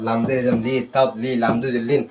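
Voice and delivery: ordinary talk, nothing marked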